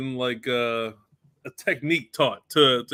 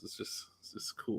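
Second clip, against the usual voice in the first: whispering; 5 semitones lower, 18 dB quieter than usual. Real, not acted. Trailing off softly.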